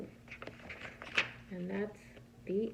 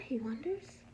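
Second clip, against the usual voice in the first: plain reading voice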